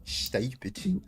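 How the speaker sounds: mock British accent. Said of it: "You ever heard a British person say stupid?".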